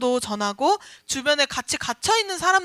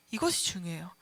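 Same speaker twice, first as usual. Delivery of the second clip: soft whisper to stress the point; whispered emphasis; 8 semitones lower, 9 dB quieter, faster than usual. Real, not acted. Preacher whispers to the congregation that this matters. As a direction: lean in and whisper it.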